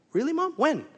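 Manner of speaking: high-pitched, hammed-up disbelief; mock incredulous. Son asks mom when his break comes, mock incredulous. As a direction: pitch leaps up in mock protest.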